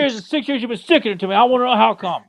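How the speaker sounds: high, fast whining complaint; whiny Paulie voice. Retold or imitated. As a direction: whine it like a drunk brother-in-law.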